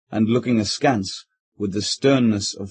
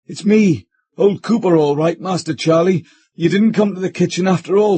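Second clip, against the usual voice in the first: higher, louder reassurance; calling back to master; 6 semitones higher, 4 dB louder than usual. Acted. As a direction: reassure the frightened squire through the door. Cooper answering his master.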